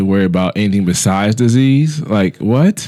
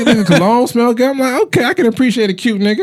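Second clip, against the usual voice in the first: pitched way up, playful; high mock admiring; 10 semitones higher than usual. Admiring a cute guy, high playful voice. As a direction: gush a little but keep it cool.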